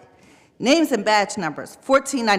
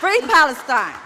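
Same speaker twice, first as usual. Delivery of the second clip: loud, raised-pitch slogan; protest cry; 5 semitones higher, 5 dB louder than usual. Real, not acted. Resident calling out as her time is cut off, defiant. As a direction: call it out defiantly.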